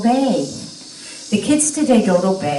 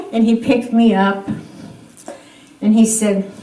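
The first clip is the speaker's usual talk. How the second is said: ordinary talk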